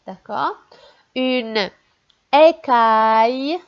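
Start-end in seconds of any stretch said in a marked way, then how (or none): 2.3-3.6 "écaille" said slowly and loud with the "aille" drawn out; "écaille" read slowly and loudly with a clear "aïe"; slowly read word. Shown as a demonstration. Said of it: "c'est A I et deux L".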